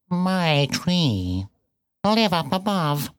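very slow, spat-out cat voice; bitten-off words. A narrator's reading. Cat explaining queens to the piglet, words bitten off. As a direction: spit out each word separately. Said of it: "seemed to bite off its words and spit".

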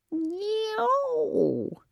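high yowling cat cry; a sharp high miaow-like yow. Acted. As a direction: let out a cat's yowl. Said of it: "she said, or that's what it sounded like".

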